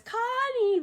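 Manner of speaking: high, coaxing call of a name; sweet-talking Mushu. Retold or imitated. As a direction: coax the horse like a sweetheart. Mushu sweet-talks the horse.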